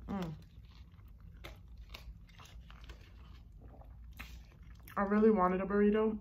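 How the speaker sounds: chewing while talking; speaking between bites; mouth full. Real, not acted. Seen 4: talks while chewing, then bites into burrito at 2.5s.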